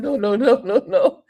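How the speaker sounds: high, loud protest through laughter; laughing no's. Retold or imitated. Driver laughing it off to the vlogger, high and loud. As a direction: laugh while waving it off. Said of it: "he started to laugh".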